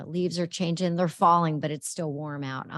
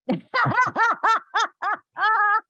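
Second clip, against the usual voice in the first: long, high, loud peal of laughter; big belly laugh; 8 dB louder than usual. Real, not acted. Co-host cracking up after teasing about getting up late, big laugh. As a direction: burst out laughing hard.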